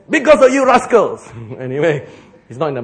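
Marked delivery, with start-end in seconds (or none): 0.1-1.3 mock grumble. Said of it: "In bracket".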